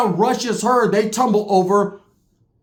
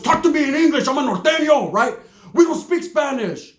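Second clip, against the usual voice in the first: higher cousin insisting; 7 semitones higher than usual. Retold or imitated. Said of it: "My cousin's".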